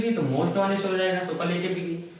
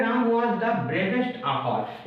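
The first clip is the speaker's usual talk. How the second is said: very slow reading; much slower than usual. Shown as a demonstration. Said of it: "यहाँ पर बात करें".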